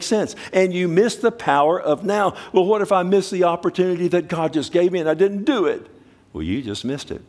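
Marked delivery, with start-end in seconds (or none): 2.5-5.9 mock worry. Voicing an anxious listener's objection.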